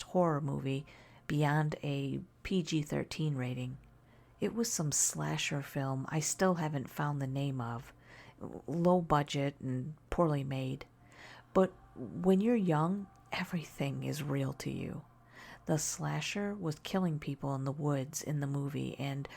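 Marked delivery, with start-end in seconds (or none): none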